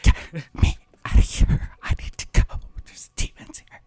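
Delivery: frantic hushed whisper; scared whisper. Retold or imitated. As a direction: whisper in panic, hurried. Acted scared whisper from movie.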